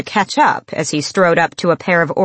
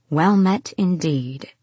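purring; 4 dB quieter, slower than usual. A narrator's reading. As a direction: purr it out slowly, sultry. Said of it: "she purred".

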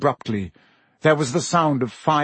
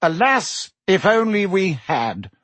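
bitter; 7 semitones higher than usual. Acted. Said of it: "said Fudge bitterly".

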